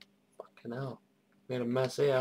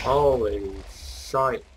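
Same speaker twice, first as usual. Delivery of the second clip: slow, loud, stunned; drawn out in awe; surprised; 6 dB louder, much slower than usual. Real, not acted. Big explosion.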